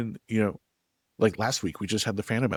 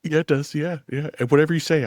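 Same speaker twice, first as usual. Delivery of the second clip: eager yes-man; 9 semitones higher, 7 dB louder than usual. Pretend. Mock eager agreement with guest.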